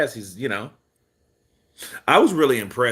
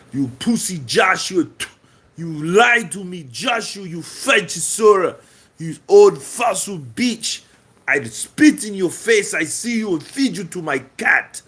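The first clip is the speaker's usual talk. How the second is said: put-on Russian accent; 6 semitones higher than usual. Boxer in a Russian accent taunting Joshua and Chisora. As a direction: spit the insults in a thick Russian accent. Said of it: "that accent is a hundred percent sounds more Russian".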